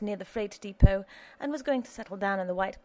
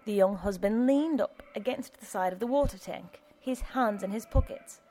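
usual speaking voice

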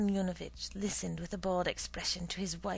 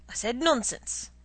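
louder, firmly repeating nonsense; insistent repeat; 5 semitones higher, 7 dB louder than usual. Acted. Husband shutting down his wife's shopping list, firm. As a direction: stamp down on the word nonsense.

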